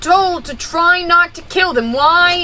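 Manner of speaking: exasperated. Real, not acted. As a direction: scold the teammate. Arguing about attacking the bear.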